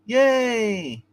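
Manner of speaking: high, drawn-out yay; little cheer. Real, not acted. Reviewer on the Jim Lee variant cover, cheering. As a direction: cheer it high without shouting.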